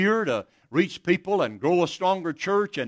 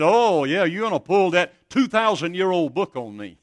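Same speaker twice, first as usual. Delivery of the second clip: mocking scoff; 4 semitones higher, 6 dB louder than usual. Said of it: "and he said".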